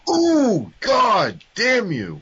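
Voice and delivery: slow, hammed-up curse at a friend; mock outrage. Friend steals his number one pick.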